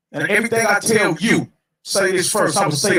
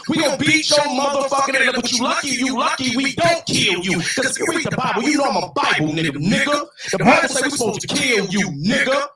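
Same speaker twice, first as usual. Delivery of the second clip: heated rant climbing higher and higher; yelling; 5 semitones higher than usual. Real, not acted. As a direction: build from raised voice to high-pitched yelling. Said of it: "all that yelling and screaming".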